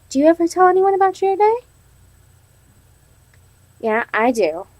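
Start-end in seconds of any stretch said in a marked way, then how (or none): none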